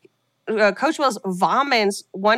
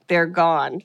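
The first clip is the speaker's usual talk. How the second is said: ordinary talk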